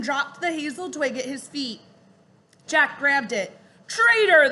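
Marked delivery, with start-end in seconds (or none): 3.9-4.5 loud, high furious shriek. Acted. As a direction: scream at the betrayer. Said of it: "the Raven King shrieked at Rock".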